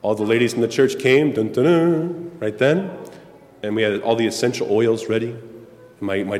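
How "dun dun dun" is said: rising, higher "dun, dun, dun"; imitated fanfare. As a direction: sing out a little arrival fanfare. Vocal fanfare as church ladies arrive.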